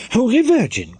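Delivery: high, loud startled cry; old dame crying out in alarm; sudden cry. Acted. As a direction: jump at the shock. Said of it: "cried she suddenly".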